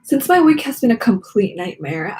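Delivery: ordinary delivery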